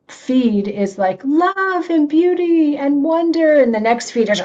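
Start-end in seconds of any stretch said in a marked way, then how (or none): 1.2-3.6 high, slow, dreamy gushing over love and beauty; mock dreamy gushing. Preacher mimicking a blissful social media feed, dreamy and slow. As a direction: swoon over the lovely feed, drawn out.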